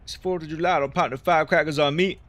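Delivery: loud, high meme-video voice; shouting the Fourth of July vine; quoting a vine. Retold or imitated. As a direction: belt it like the viral clip. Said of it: "That's my favorite Fourth of July vine".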